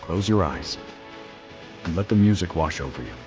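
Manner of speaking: ordinary delivery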